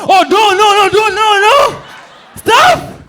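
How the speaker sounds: shrieking high terrified pleas; panicked screaming. Acted. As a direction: scream as if attacked. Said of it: "Boniface Mwangi answering his doorbell".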